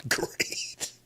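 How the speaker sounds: hushed, voiceless groan of dismay; strained whisper. Real, not acted. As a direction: force it out under your breath. Sarcastic reaction to matchup.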